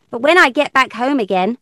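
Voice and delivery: ordinary delivery, nothing marked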